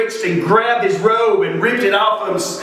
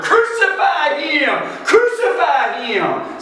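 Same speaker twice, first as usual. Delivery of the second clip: high, stretched-out cry, not truly loud; imitated mob scream; 9 semitones higher, slower than usual. Crowd demanding death from Pilate, high mock scream. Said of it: "went screaming even louder".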